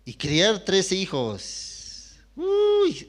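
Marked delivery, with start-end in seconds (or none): 2.4-3.1 high startled uy; mother of thirteen, high astonished uy; exclamation. Real, not acted. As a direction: marvel at the chaos.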